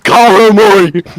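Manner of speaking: loud high yell. Real, not acted. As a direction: shout it across the room. Yelling back at host.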